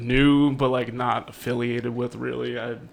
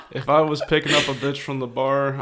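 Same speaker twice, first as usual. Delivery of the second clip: usual speaking voice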